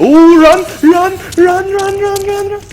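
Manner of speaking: high cries, loud then fading; frantic urging. Real, not acted. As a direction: urge a teammate to flee in panic. Fleeing zombies in game.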